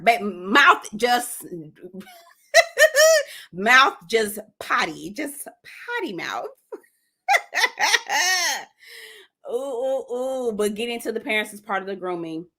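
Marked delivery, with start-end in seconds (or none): 2.5-3.5 high, loud giggle at yourself; laugh. Real, not acted. Laughs at own potty mouth. 7.3-8.6 high cackling laugh at yourself. Real, not acted. Laughs at own potty mouth.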